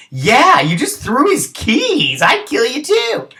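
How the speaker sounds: high, hammed-up voice cheering the keys throw; mock outraged agreement. Viewer tells the TV he'd kill him too, in a high mock squeal. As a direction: squeal it like a fan taking sides.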